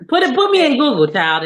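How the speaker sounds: high and loud, pushing it; emphatic plug. Real, not acted. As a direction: sell it big, put me in Google. Emphatic plug of her name.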